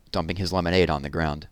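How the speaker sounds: ordinary delivery, nothing marked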